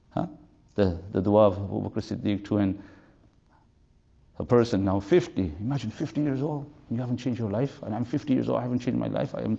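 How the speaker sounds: ordinary delivery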